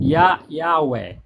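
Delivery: "Yah, Yahweh" said slowly, syllable by syllable; pronunciation demo. Shown as a demonstration. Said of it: "se pronuncie como Yah". (pronúncia lenta de "Yah, Yahweh")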